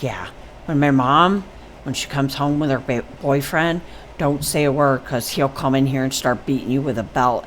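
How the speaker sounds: soft, low secretive explanation; retold warning kept under the breath; hushed warning. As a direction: quietly warn a friend of trouble. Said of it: "We gotta be quiet".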